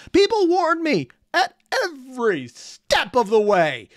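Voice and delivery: high, slow, emphatic wail; exasperated lament. Real, not acted. Reactor recalling the warnings about cliffhangers, high exasperated lament. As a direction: draw out every step in exasperation.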